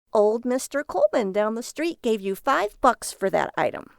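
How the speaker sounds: a sarcastic jab at his junk; mocking wife. Lily voice.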